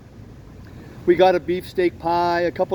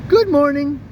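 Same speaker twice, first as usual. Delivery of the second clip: cat voice; 8 semitones higher, 5 dB louder than usual. Acted. Cat's answering farewell.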